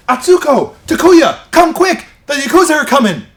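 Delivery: very loud, high, urgent cry of warning; hammed-up American anime voice actor shouting an alarm; anime dub acting. Dubbed anime character warns friends of the Yakuza, hammed-up high shout. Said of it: "anime that has English dubbing with American actors".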